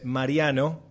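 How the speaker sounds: ordinary talk, nothing marked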